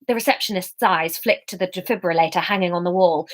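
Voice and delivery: ordinary delivery, nothing marked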